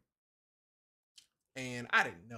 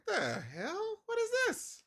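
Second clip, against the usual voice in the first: high-pitched, incredulous squawk; retold outrage, pitch shooting up; upset disbelief. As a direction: act out appalled questioning of the radio. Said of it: "'cause I was very upset".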